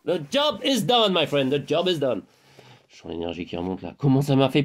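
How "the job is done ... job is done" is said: mock English. Mock English triumphant line.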